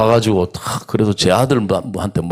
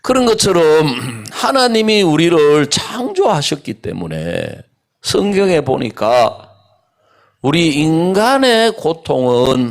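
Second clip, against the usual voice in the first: slow, rising preacher's build; preaching; 5 semitones higher than usual. Acted. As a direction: preach it, slowing for weight. Preaching continues. (설교하듯 천천히 고조되며)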